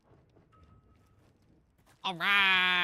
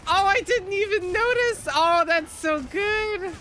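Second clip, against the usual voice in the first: high, loud gush of delight; loud, high-pitched excitement, last words stretched; delighted surprise; 12 semitones higher, 8 dB louder, slower than usual. Real, not acted. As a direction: squeal it out, thrilled. Delighted discovery of friend's Woody cosplay.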